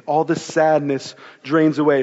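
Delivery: usual speaking voice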